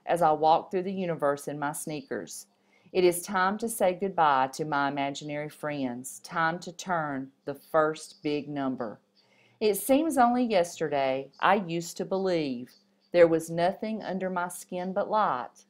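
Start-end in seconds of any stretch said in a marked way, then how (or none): none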